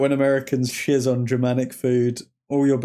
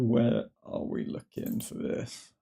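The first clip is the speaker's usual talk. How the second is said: muttering to self; 2 semitones lower, 10 dB quieter, slower than usual. Real, not acted. Muttering while searching ratings.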